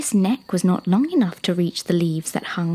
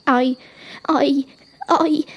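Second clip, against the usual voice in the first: high, loud halting stammer; 6 semitones higher, 5 dB louder, slower than usual. Acted. Young giraffe answering a talking rock, high frightened stammer. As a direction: stammer in short bursts, rising higher. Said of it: "stammered Eddie".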